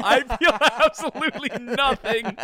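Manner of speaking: high-pitched, laughing through the words; cracking up mid-sentence. Real, not acted. Cracking up while insisting he feels nothing.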